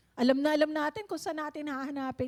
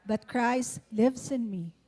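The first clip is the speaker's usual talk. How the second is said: solemn scripture reading; 6 dB louder, slower than usual. Shown as a demonstration. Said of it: "okay, let's all read this".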